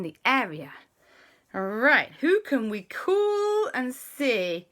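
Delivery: high, slowed scientist voice. Acted. J B planning with the boys, high and slow. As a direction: play J B thinking it through. J B character speaks.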